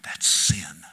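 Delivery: low, hushed whisper; God answering the onlooker in a low whisper; God's whispered answer. Acted. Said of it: "And God would say to you".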